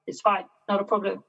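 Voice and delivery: ordinary talk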